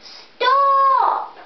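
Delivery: high, loud cry of stop; a child's shouted stop. A narrator's reading. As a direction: yell it out to the monkeys. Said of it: "Before the man could shout".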